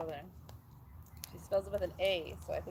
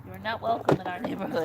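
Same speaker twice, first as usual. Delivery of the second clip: mock shout; 9 dB louder than usual. Yelling at loud traffic.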